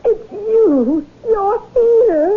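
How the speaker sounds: woman weeping with joy at her rescuer; tearful joy. Acted. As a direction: sob out the happy reunion. Reunion with lost love.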